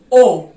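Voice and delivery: ओ held long and loud; German long O. Shown as a demonstration. Teacher modelling a long German ओ. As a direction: stretch ओ out, stressed. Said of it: "और फिर आता है ओ".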